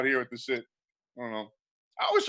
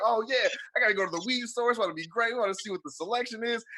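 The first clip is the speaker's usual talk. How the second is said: excited buyer; 7 semitones higher than usual. Retold or imitated. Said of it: "you be like".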